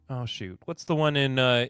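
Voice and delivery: ordinary talk, nothing marked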